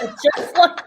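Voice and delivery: usual speaking voice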